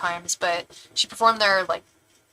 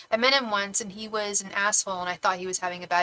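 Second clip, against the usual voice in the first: ordinary talk, nothing marked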